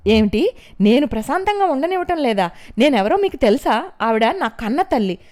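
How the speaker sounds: loud, high indignant yelling; angry shouting. A narrator's reading. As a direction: shout her rights at them. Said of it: "మళ్ళీ గట్టిగా అరిచినట్లుగా అంది".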